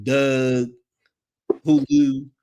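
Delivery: DOUG and HULU in Baltimore vowels; isolated sample words in a Baltimore accent. Shown as a demonstration. Host lists example words in a Baltimore accent. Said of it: "I can do the Baltimore accent".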